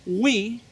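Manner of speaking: WHY wrongly said as WE, "ui"; a faulty why that comes out as WE; mispronounced why. Shown as a demonstration. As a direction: show the learner's error, saying WE for why. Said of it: "It is not".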